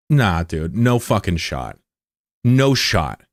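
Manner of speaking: normal talking voice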